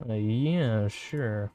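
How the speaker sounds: ordinary delivery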